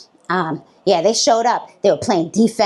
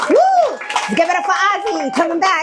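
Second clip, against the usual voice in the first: host hype; 9 semitones higher than usual. Real, not acted. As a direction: hype the crowd for the next comic. Comic introduces the next act.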